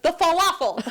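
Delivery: high mock insistence through a laugh; laughing impression of an adamant sleep talker; insistent sleep talker. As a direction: imitate him, high and urgent. Imitates husband insisting in his sleep.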